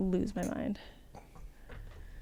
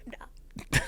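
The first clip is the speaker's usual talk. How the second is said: usual speaking voice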